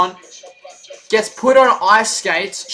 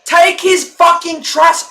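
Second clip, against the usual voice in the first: loud, high yelling, half laughing; real furious fan yell; angry shout; 7 semitones higher, 5 dB louder, slower than usual. Real, not acted. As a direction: scream it at the TV. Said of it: "I know I sound angry".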